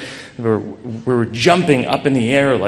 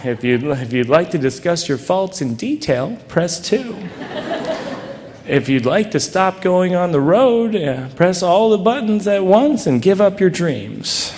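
higher, cheery recorded-menu voice; automated phone menu; 7 semitones higher than usual. Retold or imitated. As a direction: read it like a hotline recording. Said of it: "And she'd say".

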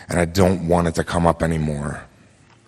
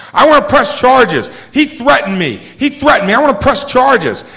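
high, loud mock indignation; outraged yelling; 6 dB louder than usual. Handcuffed man protesting to the cops, high outraged yell. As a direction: imitate a man yelling his complaint.

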